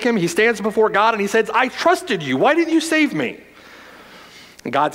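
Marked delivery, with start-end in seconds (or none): 1.5-3.4 mild indignant plea. Acted. Drowned man complains to God.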